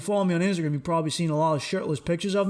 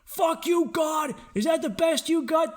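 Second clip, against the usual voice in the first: Lieutenant Dan defiant shout; 9 semitones higher than usual. Retold or imitated. Comic imitating Lieutenant Dan yelling at God in the storm. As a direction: scream defiance up at the heavens. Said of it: "I'm like Lieutenant Dan in Forrest Gump".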